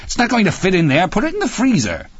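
high, rapid scolding; impatient cry. A narrator's reading. Wife sending husband to the freezer, impatient cry. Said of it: "she cried impatiently".

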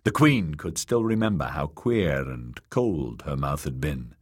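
plain reading voice

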